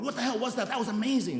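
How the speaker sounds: high-pitched, giddy astonishment; amazed excitement. Retold or imitated. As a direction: gush to your sponsor in sheer amazement. Acts out amazed self to sponsor.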